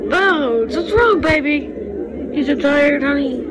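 sweet sing-song comfort for a pet. Real, not acted. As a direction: coo over a tired little one. Mother soothing upset baby dog.

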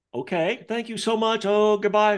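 hasty goodbye. Retold or imitated. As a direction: bolt for the door with thanks. Cleaner hurrying out the door.

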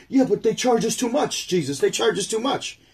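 high, whining complaint to Jesus; high put-on voice of a griping crowd member; whiny complainer voice.

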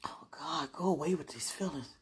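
breathless whispered oh God; barely voiced plea to God; a whisper under the breath; whispered prayer. Real, not acted.

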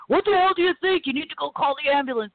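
mock his hard-charging screen roles with a sharp yell; stern yell. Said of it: "He's always got this really stern sort of".

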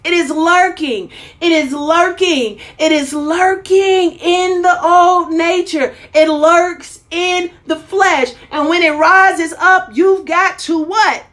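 high, emphatic preacher cadence; fired-up preaching. Real, not acted. Preacher urging the congregation to offer worship, fired-up preaching. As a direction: preach it with rising pitch, drawing out key words.

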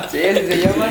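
high laughing agreement. Real, not acted. Friends laughing at the driver's nickname, laughing.

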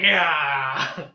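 big drawn-out whoop; loud, hammed-up cheer; mock excited yell. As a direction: yell it out like a triumphant jump. Acting out excited jump with hose.

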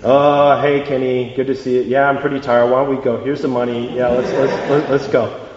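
tired, low-energy hello; low, unenthusiastic mumbled welcome; begrudging greeting. Acted. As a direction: greet a friend you'd rather not have met. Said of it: "how would he greet him at the train terminal?".

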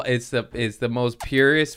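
ordinary talk, nothing marked

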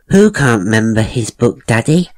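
put-on a curious little girl asking Daddy; a young daughter piping up to her father at breakfast.

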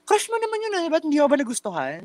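high-pitched mocking quote; imitated dismissive people. Others brush it off because it was a crush, mocked in high voice. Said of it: "people saying na parang".

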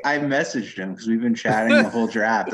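ordinary talk

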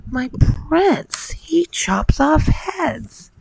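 high, slow storybook wail; hammed-up heartbroken dismay; dismayed cry. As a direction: cry it high, stretching it out in mock horror. Cindy's horrified cry in the story.

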